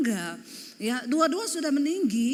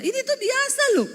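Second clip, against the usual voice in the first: very high, protesting mock-annoyed retort; nada nesu; 12 semitones higher, 4 dB louder than usual. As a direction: insist with the voice shooting up. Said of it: "nadamu tuh nesu loh". (protes nada tinggi, seperti marah)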